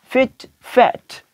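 फिट with short i, फैट with open a, both slow and drawn; teacher modelling फिट against फैट; careful minimal pair. Shown as a demonstration. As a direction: slowly contrast फिट and फैट. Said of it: "सही word जो कि soft sound है".

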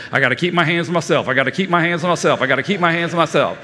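fast, repetitive imitation of a kid's chant; rapid self-talk. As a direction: rattle off reminders like a child drilling himself. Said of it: "Self-talk is great".

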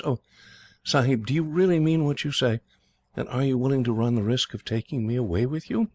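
put-on a timid slave girl begging to be believed; young girl Annie, pleading. A narrator's reading. Annie voiced by narrator.

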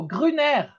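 GRUNER said the French way, silent T, drawn out; French pronunciation. Shown as a demonstration. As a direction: say Gruner as in French, ending on an open "air". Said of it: "I wanna hear it the French way".

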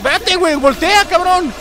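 high, loud urgent shout; urgent yelling. Real, not acted. Player orders his character to turn around, urgent shout. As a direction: yell it as a frantic order.

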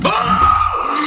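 real high, loud scream of fright; high shriek of shock; startled scream. Real, not acted. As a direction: scream at a sudden jump scare. Jump scare in horror game.

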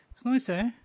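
nice said high and loud; high, loud compliment. Acted. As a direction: squeal it out brightly. Repeats compliment louder and higher.

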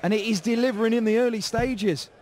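loud, thrilled commentator; excited shout. Real, not acted. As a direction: raise the voice over the crowd. Commentator reacts to back-to-back maximums.

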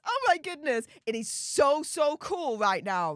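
usual speaking voice

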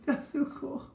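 breathless fangirl awe; gasping delight. Real, not acted. Amazed fan reaction.

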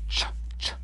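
"Ch, ch" hissed on its own, no voice; isolated ch sound. Shown as a demonstration. Teacher sounding out "ch" alone, breathy and unvoiced. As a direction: isolate the "ch" for learners. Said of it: "this ch sound".

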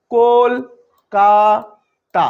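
"Kolkata" very slowly in three separate beats; syllable by syllable. Shown as a demonstration. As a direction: stretch and split "Kolkata" into কল, কা, তা. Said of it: "কতবারে উচ্চারিত হচ্ছে".

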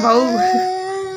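long drawn-out sob on the word; crying. Real, not acted. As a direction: sob it out slowly, stretched. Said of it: "रो रो के बहू".

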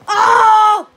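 loud burning reaction; roast shout. Real, not acted. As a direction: yell Oh like a crowd.

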